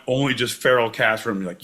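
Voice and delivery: ordinary talk, nothing marked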